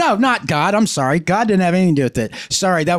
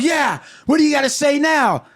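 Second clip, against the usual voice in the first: high-pitched defiant comeback; hammed-up gloating challenge; triumphant taunt; 8 semitones higher than usual. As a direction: rub it in, shouting. Acted wife taunts husband.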